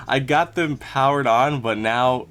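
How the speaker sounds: ordinary talk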